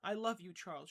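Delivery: loud, high cry of love through sobs. Acted. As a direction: cry it out, half sobbing. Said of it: "she cried again".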